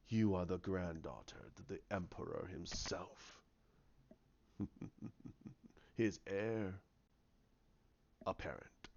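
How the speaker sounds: low, hushed villain reveal; soft, deep voice savoring the reveal; sinister villain. Acted. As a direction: drop to a quiet, ominous near-whisper. Villain reveals Rey's lineage.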